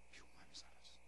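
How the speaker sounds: soft whispered confession; hushed. Acted. Preacher quietly telling the church, whispered. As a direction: drop to a whisper.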